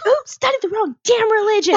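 high mock yell. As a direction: yell it out sarcastically. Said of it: "And I yelled at her".

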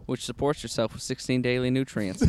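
quiet mock ad read. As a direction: read it like a sponsor spot. Fake ad read.

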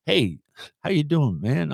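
casual mock-American greeting; John Daly. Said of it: "John Daly had come along and go".

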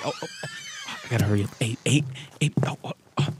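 ordinary talk, nothing marked

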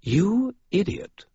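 slow, high, cutting British put-down; crisp British contempt; precise British accent. Acted. Vampire scolding Maroon, precise British accent. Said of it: "in a precise British accent".

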